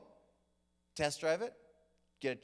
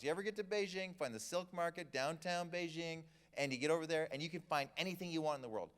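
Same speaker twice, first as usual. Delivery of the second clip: ordinary talk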